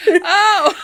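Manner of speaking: high bursts of laughter; laughing. Real, not acted. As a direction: crack up at the joke. Laughing at anteater joke.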